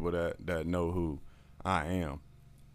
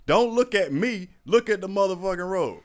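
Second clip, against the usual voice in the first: higher, urgent orders from the passenger seat; alarmed scolding re-enactment; 8 semitones higher, 4 dB louder than usual. Retold or imitated. Passenger to distracted driver on wet road, urgent scolding. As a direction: act out scolding a careless driver.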